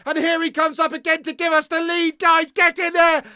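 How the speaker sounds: loud, high, building to a shout; excited live commentary. Real, not acted. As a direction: call the goal with mounting excitement. Commentary rising into goal celebration.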